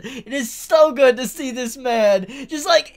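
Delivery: high and loud, words squeezed through laughter; can barely get it out for laughing; laughing excitedly. Real, not acted. Laughing over Furuta.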